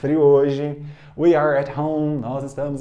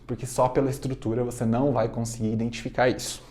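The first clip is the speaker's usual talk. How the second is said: ordinary talk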